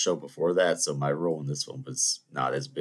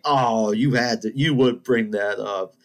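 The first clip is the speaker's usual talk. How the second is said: put-on slow, higher teasing reproach to an old pupil; playful exasperation; 5 semitones higher, 6 dB louder, slower than usual. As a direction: imitate a drawn-out, loud mock complaint. Teacher mock-groans at old memory.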